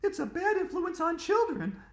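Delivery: put-on very high, loud bossy little girl; girl corrects a classmate, high and bossy; snooty know-it-all girl. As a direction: squeak it out, correcting him with scorn. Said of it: "said Andrea".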